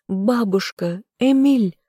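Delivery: desperate call to the captives; alarmed call. A narrator's reading. Heroine lunging toward her captive friends, alarmed call.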